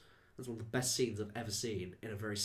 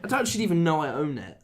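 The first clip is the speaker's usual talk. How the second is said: high, loud comic outburst; exasperated exclamation; 7 semitones higher, 13 dB louder, faster than usual. Real, not acted. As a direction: exclaim it loudly, pitched right up. Exasperated joke about lost disc.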